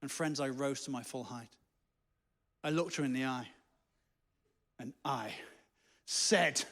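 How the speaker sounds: low, soft, portentous build-up; mock-heroic. Real, not acted. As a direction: draw it out with weighty pauses, like an epic hero. Mock-heroic build-up before refusal.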